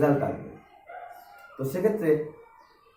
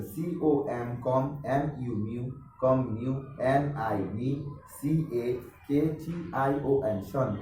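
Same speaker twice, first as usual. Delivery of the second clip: slow, lowered spelling in groups, each followed by its sound, কম, মিউ, নি, শন; syllable-by-syllable spelling; 3 semitones lower, 4 dB quieter, much slower than usual. Shown as a demonstration. Teacher spells communication piece by piece, slowly. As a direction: spell a chunk, sound it, move on to শন. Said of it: "শব্দের খণ্ডিত ছোট ছোট অংশ".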